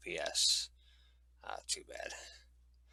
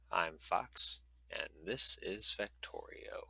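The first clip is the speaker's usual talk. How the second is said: usual speaking voice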